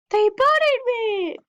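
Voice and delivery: high, drawn-out excited cry; elated. Real, not acted. Player celebrating a new buddy, elated. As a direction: squeal it out in delight.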